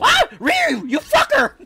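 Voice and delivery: high, slow laughing outrage; outraged yell. Real, not acted. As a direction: yell at the teammate who blocked you. Teammate blocked his escape.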